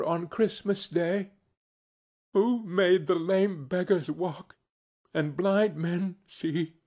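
a touch of tender trembling; tremulous. A narrator's reading. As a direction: tell it with restrained emotion, voice lifted. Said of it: "Bob's voice was tremulous when he told them this".

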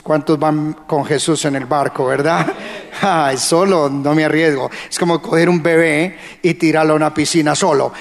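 normal talking voice

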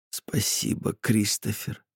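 soft tearful whisper. Acted. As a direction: breathe the thanks quietly. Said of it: "прошептала Ингри". (шёпот)

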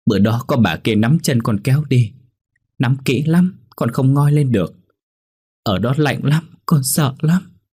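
put-on high, scared child's voice; frightened child. Drowned boy telling mother how cold and scared he was. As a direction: tremble as the drowned boy recalling it.

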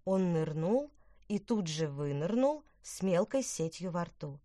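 ordinary delivery, nothing marked

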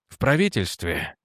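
low, even tone over irritation; restrained irritation. A narrator's reading. Edwards talking to Jannek about the government, irritation held under even intonation. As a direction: keep annoyance under a level voice. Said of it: "удерживая раздражение под ровной интонацией".

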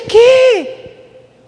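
very high, loud mock astonishment; shocked disbelief. Preacher reliving his reaction to the healing, shocked disbelief. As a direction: blurt it out high, acting stunned.

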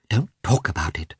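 quick, agitated plea to drop the subject; excited. Acted. Anna begs Betsy to drop it, excited. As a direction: cut the topic off with nervous energy. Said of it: "answered Anna in an excited voice".

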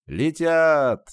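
громкий высокий выкрик; заорать на всю толпу; крик. A narrator's reading. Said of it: "заорал кто-то". (протяжный крик из толпы)

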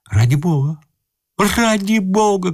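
high-pitched pleading; crying out. A narrator's reading. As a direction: beg for mercy, voice climbing. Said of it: "кричал Иван Андреевич".

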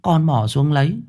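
put-on high child's voice, eager storytelling; a young boy recounting the river; boy's voice. A narrator's reading. Boy tells his story. (giọng cậu bé cao, kể lại hào hứng)

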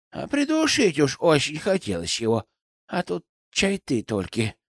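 put-on creaky, grumbling old crone; croaky old woman voice. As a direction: croak it out like an old forest granny. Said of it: "кряхтящим старушечьим голосом".

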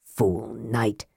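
low, soft bitterness; bitter. A narrator's reading. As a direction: mutter the insult low and bitterly. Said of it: "Raistlin added bitterly".

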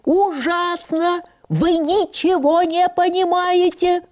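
high, slow, furious outburst; shouting angrily. A narrator's reading. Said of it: "закричал".

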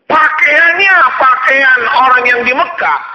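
impassioned preacher; fiery preaching. Acted. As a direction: drive the warning home to the congregation. Preaching at full force.

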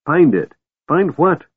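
raving shout at Barham. A narrator's reading. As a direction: yell the questions in frustration. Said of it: "Tanis shouted".

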